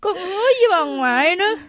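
crying, contrite apology; tearful pleading. Acted. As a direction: beg forgiveness through tears. Grandchild tearfully begs grandmother's forgiveness.

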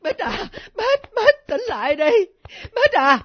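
high, tearful calls; frantic rousing. Acted. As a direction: beg the child to wake up. Grandmother tries to rouse fainted child.

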